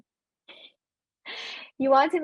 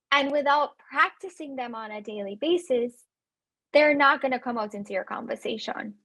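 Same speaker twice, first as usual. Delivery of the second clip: ordinary talk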